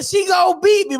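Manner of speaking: high-pitched retold yell; imitated kid's protest, pitched high; mock screaming. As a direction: wail it like a panicked kid. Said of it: "I'm just screaming, yelling".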